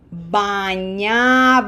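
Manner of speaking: bañaba drawn out slowly with long, open A's; bañaba, syllable by syllable with full "ah" vowels. Shown as a demonstration. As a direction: stretch every A in bañaba, mouth wide open. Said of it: "Long A's, open mouth for all of them".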